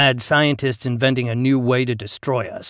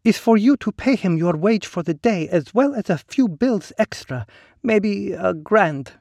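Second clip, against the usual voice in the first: high, silky and knowing; smooth woman's voice; 10 semitones higher, 3 dB louder than usual. Acted. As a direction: coax him smoothly, higher up. Ligny character voice.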